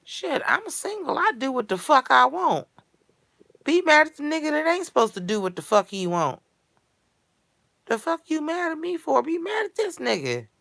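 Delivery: high-pitched, defiant protest; indignant, voice riding high; indignant rant. Real, not acted. Ranting at those who blame her.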